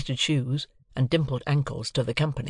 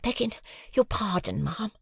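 higher, soft deferential maid; polite housemaid; 8 semitones higher, 3 dB quieter than usual. A narrator's reading. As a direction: apologise but hold firm. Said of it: "returned the housemaid".

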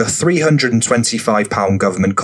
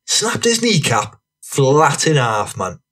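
higher, slow, savoured punchline; rowdy storyteller; 5 semitones higher, slower than usual. Acted. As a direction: stretch out the gory detail. Voices Franny leaning in, finishing the tale.

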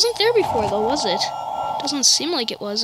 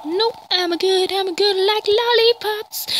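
silly refusal; 8 semitones higher than usual. Pretend. Nervous silly refusal in horror game.

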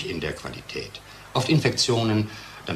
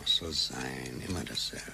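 slow, soft and sunk in gloom; weary murmur after a bad day; depressed; 8 semitones lower, 11 dB quieter than usual. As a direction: drag the words out, worn down and dejected. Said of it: "Bist du deprimiert?".

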